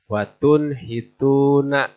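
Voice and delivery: Watun hituna slowly, nun distinct before the h; slow careful recitation; clear nun. Shown as a demonstration. Teacher recites an example slowly. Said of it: "Harus jelas".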